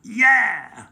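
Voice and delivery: loud, high cheer; shouted cheer. Acted. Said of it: "shouted the pirates".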